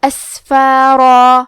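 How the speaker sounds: اسفارا very slow, with a full, heavy را and a long final alif; اسفارا, the را thick and the alif held; اسفارا with the ending made heavy and stretched. Shown as a demonstration. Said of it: "را کو اور مد الف کو دونوں کو بھاری آواز میں".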